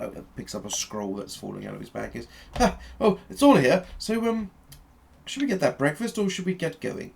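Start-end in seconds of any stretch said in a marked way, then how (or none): 2.6-7.1 high-pitched, relieved Doctor voice; excitable Doctor. Acted. Doctor with his things back, asking the party, eager. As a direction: chatter eagerly in a high character voice. Game master plays the Doctor.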